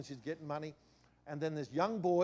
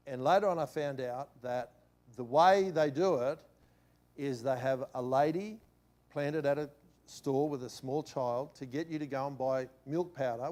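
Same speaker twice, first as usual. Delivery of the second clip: ordinary talk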